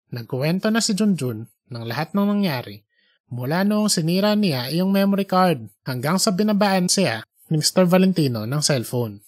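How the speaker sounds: ordinary delivery, nothing marked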